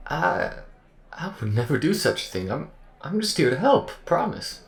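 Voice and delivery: high, earnest folksy voice; eager AI character. Acted. Accused AI swears to the crew it will help, high and earnest. Acted AI character pleading its case.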